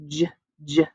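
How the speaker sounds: crisp repeated "j" sound; letter sound demo. Shown as a demonstration. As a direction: isolate the "j" sound twice, clipped and clear. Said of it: "what letter would I have to put there for j".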